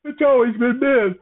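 exaggerated high mock protest; mock objectors. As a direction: imitate people protesting, pitched well up. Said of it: "I know some people will be like".

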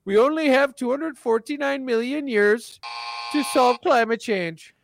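a hint of a preachy activist; mocking Greta. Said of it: "Greta Thunberg's gonna be saying".

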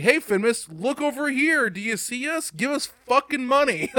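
high, loud mock sales pitch; loud, high mocking impression of hucksters; mock pandering pitch. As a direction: call out to the crowd, hammed-up and high. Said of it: "they're just like".

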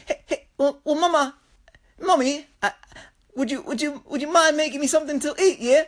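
put-on high, politely pestering Australian child voice; Stewie. Kid asks Mom for food for his tummy, Stewie-like imitation. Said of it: "or, or Australian Stewie".

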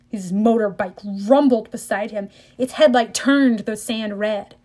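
ordinary delivery, nothing marked

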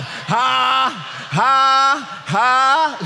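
big, sustained "ha" calls; demonstrated forced laugh. Shown as a demonstration. Preacher coaxing dignified men to laugh, big forced laugh. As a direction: push out each ha to get others laughing. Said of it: "Come on and laugh".

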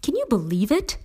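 high-pitched, outraged disbelief; incredulous appeal. A narrator's reading. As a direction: appeal to them in indignant disbelief. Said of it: "Her eyes said".

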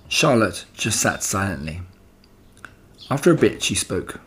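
plain reading voice

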